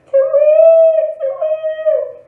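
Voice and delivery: very high, loud owl hooting; piercing acted owl screech; cross owl hoot. A narrator's reading.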